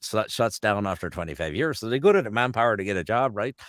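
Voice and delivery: broad Newfie storyteller's accent; Newfoundland accent. Shown as a demonstration. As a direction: spin a yarn like a Newfoundlander. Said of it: "jump the pond over to Newfoundland".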